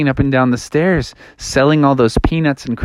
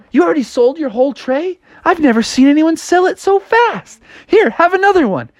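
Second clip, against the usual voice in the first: high-pitched astonished exclaiming; hammed-up amazement in a high voice; amazed boss; 12 semitones higher than usual. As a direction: marvel at it, pitch way up. Amazed bosses see empty tray.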